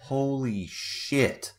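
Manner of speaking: slow, stunned holy shit; stunned aside. Retold or imitated. Watching the manager rage, slow stunned disbelief. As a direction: let it sink in, awestruck. Narrator's stunned inner reaction.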